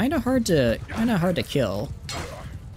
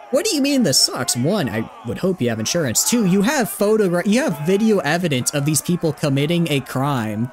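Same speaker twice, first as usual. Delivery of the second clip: mock-outraged higher-pitched rebuttal; exasperated indignation; 4 semitones higher than usual. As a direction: lecture him in disbelief, hitting each point. Acted indignant reply to film dad.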